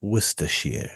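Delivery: "Worcestershire" said as "Wuss-tuh-sheer"; British pronunciation. Shown as a demonstration. Repeating it for a friend learning, British pronunciation. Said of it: "it's pronounced".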